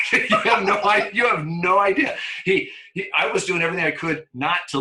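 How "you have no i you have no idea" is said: high, loud, laughing through the words; can barely get it out for laughing; real laughter breaking through. Real, not acted. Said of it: "laughing while saying it".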